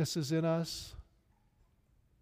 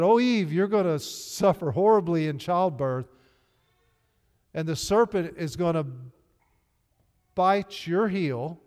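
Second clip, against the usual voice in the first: lightly acted God, loud and raised in pitch; higher, forceful quoted pronouncement; God speaking to Eve; 4 semitones higher, 8 dB louder than usual. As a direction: loudly proclaim it as God's words. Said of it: "do you know what God said to Eve".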